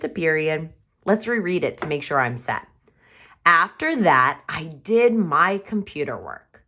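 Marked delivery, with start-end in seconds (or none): 3.4-6.6 reading aloud. Shown as a demonstration. Teacher reads her story aloud.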